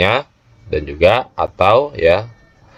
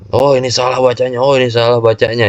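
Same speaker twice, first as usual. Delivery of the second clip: higher-pitched imitation of noisy objectors; raised, insistent mock objection; mimicked fault-finding; 5 semitones higher, faster than usual. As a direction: mimic people calling out a reading mistake. Mimicking people who fault others' reading.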